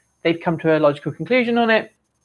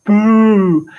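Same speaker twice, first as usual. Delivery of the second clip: loud booing jeer in a put-on voice; mocking boo; 8 dB louder than usual. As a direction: boo loudly like a jeering old crone. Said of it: "Reminds me of that old lady in".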